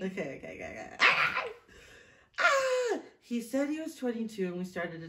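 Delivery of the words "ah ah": high excited shriek; squeal. Real, not acted. Bracing to reread the romantic part, high squeal. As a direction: squeal to shake off nerves.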